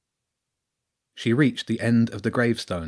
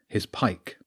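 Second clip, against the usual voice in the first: usual speaking voice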